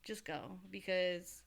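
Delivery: soft, dropping to a whisper at the end; quiet weary aside. Retold or imitated. Mom quietly telling Val to leave, fading to a whisper. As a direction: hush it like you're worn out.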